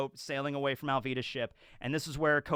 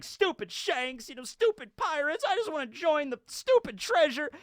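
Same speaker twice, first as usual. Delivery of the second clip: mock childish tantrum; 12 semitones higher than usual. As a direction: imitate a sulking kid, pitched way up. Acting out a sulking young Luffy.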